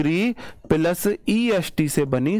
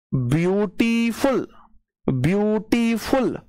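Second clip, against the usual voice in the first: Beautiful slowly stretched as ब्यू-टी-फुल, higher pitch; syllable by syllable; 4 semitones higher, much slower than usual. Shown as a demonstration. Teacher showing students the syllables of beautiful, slow and stretched. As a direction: draw out each syllable of beautiful. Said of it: "कहीं ना कहीं मैं pause ले रहा हूं".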